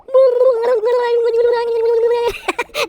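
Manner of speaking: high, drawn-out silly orca call; goofy imitation orca call; orca noise. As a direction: make up a long, wailing whale noise. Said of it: "how to make an orca noise".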